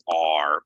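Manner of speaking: "are" with an open ah plus R; wrong vowel. Shown as a demonstration. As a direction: model the mistaken "are" loudly. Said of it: "people wanna say".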